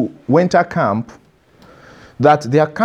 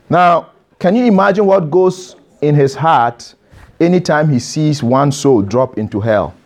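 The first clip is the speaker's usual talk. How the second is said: ordinary talk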